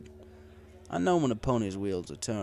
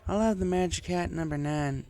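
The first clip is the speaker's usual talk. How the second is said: higher country mare voice, slurring a touch; Applejack voiced as drunk farm mare; 9 semitones higher than usual. A narrator's reading. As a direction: play the tipsy farm girl venting.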